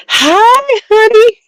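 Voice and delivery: greeting a friend joining the stream; sing-song greeting. Real, not acted. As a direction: sing out the hi, high and bright. Greets a viewer joining live.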